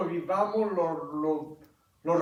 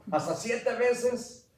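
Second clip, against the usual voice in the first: "¿Hasta siete veces?" much higher and slow, pleased with itself; proud offer; 7 semitones higher, much slower than usual. Retold or imitated. Peter proudly offering to forgive seven times, high slow. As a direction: offer it as if generous. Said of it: "lo doblo y le echo un pilón".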